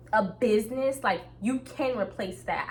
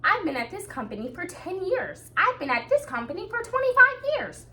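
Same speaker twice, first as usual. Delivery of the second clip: high, rising mock voice of a company lifer; mock the long-time employee in a whiny high voice; hammed-up tired worker bragging about years; weary lifer employee; 11 semitones higher than usual. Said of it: "I'm not gonna be the person to be like".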